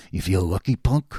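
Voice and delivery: lightly voiced low Clint Eastwood growl; a hint of a squinting tough guy. To the smiling banker, a mild Clint Eastwood menace. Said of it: "like Clint Eastwood".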